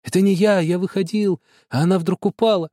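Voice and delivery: high, anxious repeated denial; insistent pleading. A narrator's reading. Boy keeps denying to the family, anxious. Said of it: "и только твердил".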